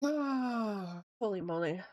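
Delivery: slow sighing exhale; exhausted sigh. Real, not acted. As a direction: sigh it out slowly.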